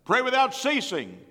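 high, loud scripture proclamation; proclaimed command. Real, not acted. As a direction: ring out the command. Preacher quoting scripture loudly.

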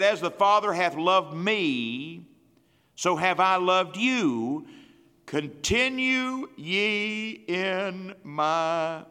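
preacher proclaiming Jesus' words; slow proclaimed quote. Acted. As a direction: give Jesus' words weight. Quoting Jesus slowly.

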